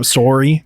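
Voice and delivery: SORRY with a Canadian SORE-ee vowel; Canadian pronunciation. Shown as a demonstration. Host teases about the Canadian accent, SORRY said SORE-ee. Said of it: "It's a Canadian accent".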